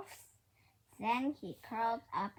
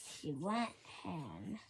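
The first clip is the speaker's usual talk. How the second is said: ordinary delivery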